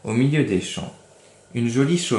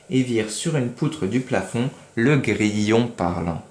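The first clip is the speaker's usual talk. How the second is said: ordinary delivery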